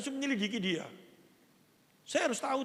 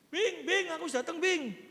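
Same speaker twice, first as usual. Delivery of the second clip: put-on high, loud childlike call home; like a little child; 9 semitones higher, 4 dB louder, slower than usual. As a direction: call out to your wife like an eager little kid. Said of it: "Kayak anak kecil gitu". (seperti anak kecil, tinggi dan keras memanggil istri)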